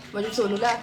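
usual speaking voice

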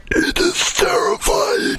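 high breathy doom voice; dramatic whisper-shout. Pretend. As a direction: proclaim doom in a hoarse whisper. Same dramatic bit.

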